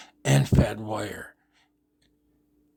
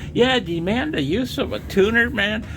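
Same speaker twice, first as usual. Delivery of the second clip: high, scornful disbelief; incredulous; 12 semitones higher, 4 dB louder than usual. Real, not acted. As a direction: throw their words back at them, much higher. Echoes the claim in disbelief.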